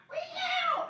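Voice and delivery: very high squealed meow; imitated cat meow, high and squeaky.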